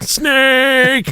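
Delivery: high mock shriek, drawn out; imitated child's scream. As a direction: scream it like a startled little girl. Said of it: "She started screaming, like".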